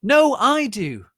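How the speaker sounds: very high, loud, slow retort; shouting. Acted. Rival shouting back over the girl, high slow.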